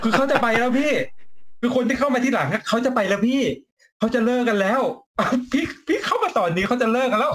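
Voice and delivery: high-pitched, laughing protest; mock protest. Mock protest, teasing latecomers over ending. (โวยแบบขำๆ เสียงสูง)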